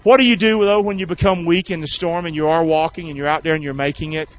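ordinary talk, nothing marked

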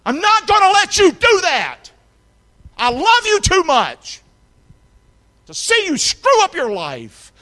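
loud, high, slow pleading shout; caring friend shouting a warning. Acted. Friend stopping you from ruining your life, loud desperate shout. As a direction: yell it like a friend who won't let you fall. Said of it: "some friend would have come into your life and would have said".

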